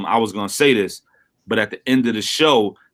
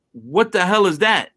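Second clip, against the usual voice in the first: mock shock; 8 semitones higher than usual. Retold shock at Wikipedia.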